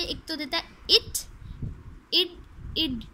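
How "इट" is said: "इट" said alone as a short syllable; ed sound demo. Shown as a demonstration. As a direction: sound out "इट" as an ending. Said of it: "वो three sounds देता है". ("इट" अलग से, छोटी ध्वनि में)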